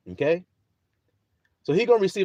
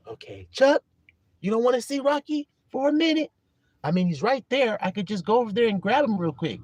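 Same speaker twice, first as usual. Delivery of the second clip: high, wheedling offer; coaxing; 6 semitones higher than usual. Acted. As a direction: coax a friend, pitched up. Coaxing Chuck to see Rocky.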